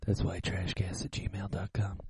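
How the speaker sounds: slow breathy whispered repeat; whispering. Pretend. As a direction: whisper it back slowly. Cohost repeats email in whisper.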